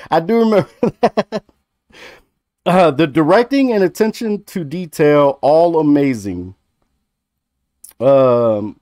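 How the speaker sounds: ordinary talk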